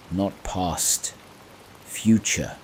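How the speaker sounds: ordinary delivery, nothing marked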